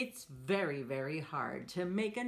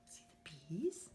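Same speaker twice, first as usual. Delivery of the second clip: whisper; 2 semitones lower, 7 dB quieter, faster than usual. Real, not acted. Hushed aside to children.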